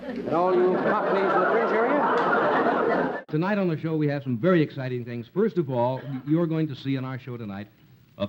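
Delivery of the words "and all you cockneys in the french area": put-on announcer voice. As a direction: play a pompous host. Host parody in put-on voice.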